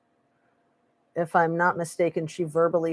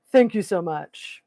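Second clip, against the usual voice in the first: Elvis impression. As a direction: croon it like [name]. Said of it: "[name] or somebody when she says that".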